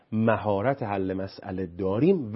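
ordinary talk

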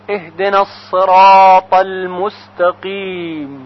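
slow, measured chanted recitation of the verses; Quran recitation. Shown as a demonstration. Teacher recites Fatiha verses for students, slow chant. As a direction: recite each phrase slowly and reverently.

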